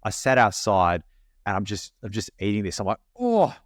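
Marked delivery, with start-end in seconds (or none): 3.2-3.5 high drawn-out moans of pleasure, much higher and louder; moaning. Retold or imitated. Eating the ploughman's board outside, moaning high and loud. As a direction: moan over the food like it's heaven. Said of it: "just moaning".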